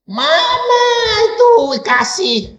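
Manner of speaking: very high, slow yell; drawn-out, high-pitched retort; wife's mock outcry. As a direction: mimic a wife challenging her husband. Acts wife challenging preaching husband.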